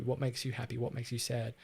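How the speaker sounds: ordinary delivery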